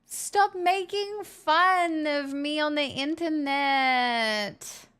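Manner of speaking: slow whiny mock American accent; slow mock American whine. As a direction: complain in a drawled American accent. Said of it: "Y'all cram so many extra syllables".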